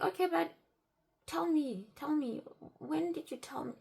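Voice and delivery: high, insistent questioning; retold pressing demand. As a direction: act out pushing him for an answer. Retold pressing him for proof.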